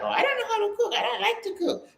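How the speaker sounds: high laughing imitation of helpless lady; lightly mock the ladies who won't cook; mock pampered lady. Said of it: "They're like".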